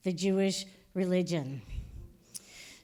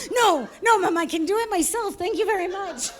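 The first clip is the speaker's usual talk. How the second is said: put-on bratty teen protest; 7 semitones higher, 4 dB louder than usual. Teen refusing mom's help.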